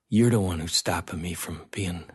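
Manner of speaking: hushed accusation trailing into a whisper; imitating a soft threat up close; lightly voiced hushed intimidation; quiet menace. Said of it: "voice, they had to lean forward to hear him".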